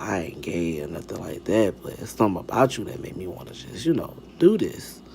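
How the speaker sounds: low, soft imitation of a man confessing. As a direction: keep it deep and hushed, mimicking his sweet talk. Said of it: "deep, like, slow voice".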